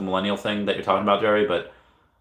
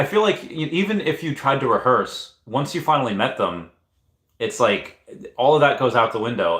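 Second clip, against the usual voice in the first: ordinary talk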